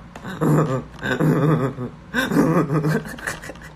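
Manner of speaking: put-on throaty laugh in short bursts; deliberately forced, stuttering chuckle; practiced new laugh. Shown as a demonstration. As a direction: try out an odd fake laugh. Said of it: "I'm practicing a new laugh".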